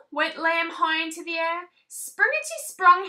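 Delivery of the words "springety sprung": higher, louder bouncy words; spring it out. A narrator's reading. Bouncy sound words in story.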